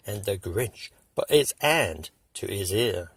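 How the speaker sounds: plain reading voice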